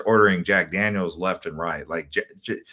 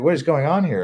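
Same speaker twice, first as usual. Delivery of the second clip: louder, higher confused outcry; baffled outcry at the yelling driver; baffled protest; 7 semitones higher, 4 dB louder than usual. Retold or imitated. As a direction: demand to know what's happening.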